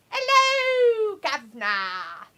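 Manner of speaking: cockney greeting, hammed up; mock London accent. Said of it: "No one says hello, governors".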